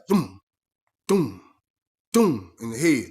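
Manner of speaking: loud punchy doom sounds; mock stomp thuds. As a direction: thump out each stomp. Imitating stomps on a head.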